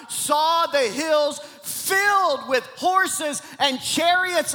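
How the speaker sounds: high, loud, drawn-out sermon delivery; full-force preaching. Acted. Preacher declaring Elisha's answer against fear, full-force preaching. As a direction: proclaim it to the whole church, stretching key words.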